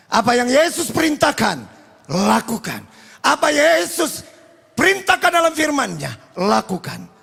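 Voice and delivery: emphatic preaching. Real, not acted. Preacher urges congregation to obey Jesus, emphatic exhortation. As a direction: swell on each call, then land the command.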